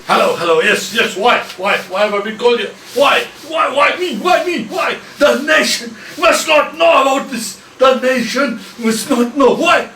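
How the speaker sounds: loud, frantic, high and pleading; a panicked man shouting why me at the nation; panicked yelling. Acted. News reporter dragged on air, yelling in panic.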